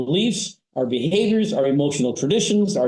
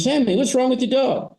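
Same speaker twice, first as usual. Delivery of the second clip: higher, puzzled salesman's question; curious salesman; 9 semitones higher than usual. Retold or imitated. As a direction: ask like a visiting salesman, a bit higher. Salesman asks farmer in story.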